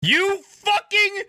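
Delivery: high, slow, loud outraged yell; furious high shout; shouting at the screen. Real, not acted. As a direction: yell it at her, dragging it out. Streamer yells at the driver.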